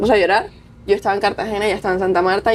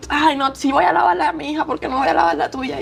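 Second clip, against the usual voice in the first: higher, fond motherly insistence; insistent mom; 8 semitones higher than usual. Retold or imitated. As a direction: brush off her refusal like a mom. Antonia insisting on laundry. (mamá insistente, más aguda)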